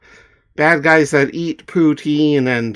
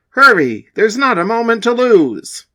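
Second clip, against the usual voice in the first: urgent call; 9 semitones higher, 3 dB louder than usual. Acted.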